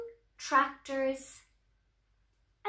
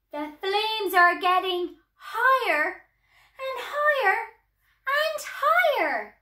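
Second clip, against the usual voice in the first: loud, high alarm climbing higher; building alarm; 7 semitones higher, 7 dB louder than usual. A narrator's reading. Storyteller watching a farm fire spread, building alarm. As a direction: raise the panic with each phrase.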